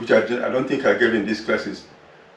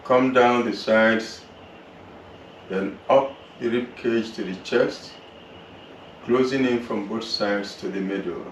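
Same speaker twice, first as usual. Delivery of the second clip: calm, unhurried relaxation voice; guided meditation; 6 dB quieter than usual. Acted. Yoga teacher guiding students through relaxation, calm meditation voice. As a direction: guide the class gently through the body.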